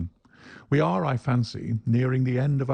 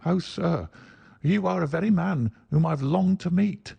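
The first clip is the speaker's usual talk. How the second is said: higher, eager cry; cried out; 8 semitones higher than usual. Acted. Little man crying out to Holmes, eager. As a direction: reach out with eager hope. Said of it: "cried the little fellow".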